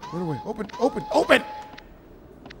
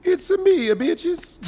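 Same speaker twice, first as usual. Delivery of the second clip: high-pitched cartoon plumber catchphrase; squeaky-high plumber greeting; Super Mario voice; 10 semitones higher, much slower than usual. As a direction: sing out high in a mock Mario voice. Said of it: "Super Mario voice".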